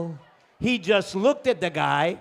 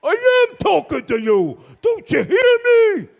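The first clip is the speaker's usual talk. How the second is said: very loud, high bellow; furious screaming; 13 semitones higher, 10 dB louder than usual. Acted. Driver to the man going deaf, screamed in frustration. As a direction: scream it at someone who can't hear. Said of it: "why are you screaming".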